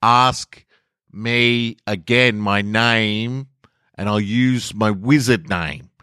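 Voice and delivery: high, slow, overly careful. A narrator's reading. Kyle telling the Grand Wizard, loud and slow. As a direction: spell it out as if to someone hard of hearing. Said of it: "Kyle spoke louder and slower".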